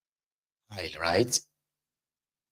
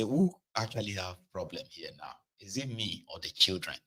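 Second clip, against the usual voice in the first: sober reflection; 3 semitones lower, 12 dB quieter than usual. Retold or imitated. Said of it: "come dey sober and say".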